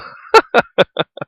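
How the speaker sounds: loud high laugh. Real, not acted. Laughing at the preacher's claim, loud high laugh. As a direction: burst out laughing.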